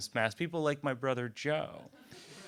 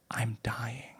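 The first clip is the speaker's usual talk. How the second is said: whisper; 5 dB quieter than usual. Retold or imitated. Mother confides in son.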